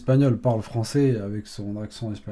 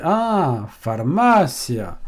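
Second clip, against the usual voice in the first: imitated sudden realisation; 3 semitones higher, much slower than usual. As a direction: act out the penny dropping. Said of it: "elle réfléchit un peu, elle dit".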